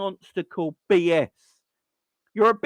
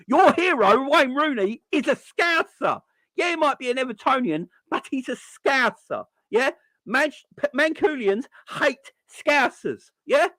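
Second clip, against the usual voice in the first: taunting; 8 semitones higher than usual. Real, not acted. Taunting Manchester fans that their hero is a Scouser, pitched up and gleeful. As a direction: rub it in their faces. Taunting Mancunians about Rooney.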